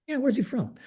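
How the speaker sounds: exasperated high repeat of the question; emphatic repeat. Retold or imitated. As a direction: ask it again, pushing harder.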